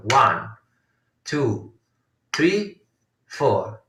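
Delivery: spaced-out count, a pause after each number; one number per beat, unhurried. Shown as a demonstration. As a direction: count out the main beats separately. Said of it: "in the second part of Aditala".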